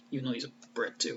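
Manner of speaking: ordinary talk, nothing marked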